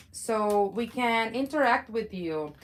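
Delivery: normal talking voice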